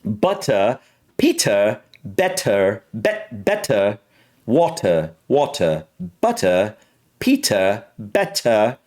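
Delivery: learner's careful British attempt, crisp T; British accent. Shown as a demonstration. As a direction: practise the British T word by word. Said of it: "it's very hard for me to do".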